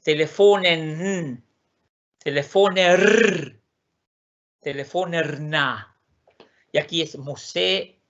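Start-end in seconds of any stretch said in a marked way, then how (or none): 0.0-6.0 telefonen, telefoner slowly, each final consonant clear; Swedish forms. Shown as a demonstration. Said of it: "la última consonante se escuche".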